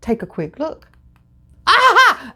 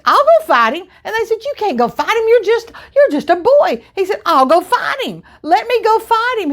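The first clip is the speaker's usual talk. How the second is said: put-on higher, fast earnest boy voice; eager boy David; 8 semitones higher than usual. David insisting to the soldiers he can fight the giant, boyish and eager. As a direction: plead like a brave little kid.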